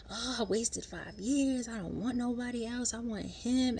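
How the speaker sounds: high, drawn-out imitation of crying; mock crying. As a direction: imitate a woman wailing over her man. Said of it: "she's crying, like, crying".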